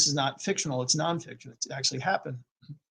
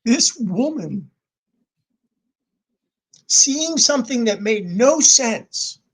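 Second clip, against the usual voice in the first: dramatic build; 6 semitones higher, 8 dB louder, slower than usual. Real, not acted. Dramatic storytelling climax.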